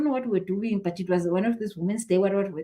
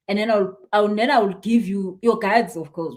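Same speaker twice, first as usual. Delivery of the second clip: ordinary delivery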